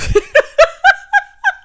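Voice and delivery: high, loud burst of real laughter; can't stop laughing. Real, not acted. Laughing at her own line.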